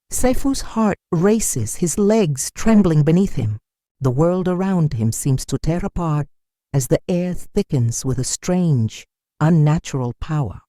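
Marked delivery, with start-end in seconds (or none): none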